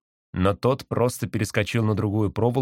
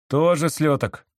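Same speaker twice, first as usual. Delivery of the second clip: higher slow answer; tourist voice; 6 semitones higher, slower than usual. A narrator's reading. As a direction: drawl it slowly. Other tourist.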